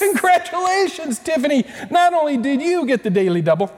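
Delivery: high, bright game show cheer; excited congratulations. Acted. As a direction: cheer the winner like a host. Host congratulates winner.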